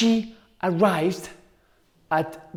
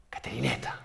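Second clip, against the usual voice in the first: softer, whispered final word; scary whisper; 4 semitones lower, 9 dB quieter, much faster than usual. A narrator's reading. Storyteller whispering the scary ending to listeners. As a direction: whisper the twist to make them jump.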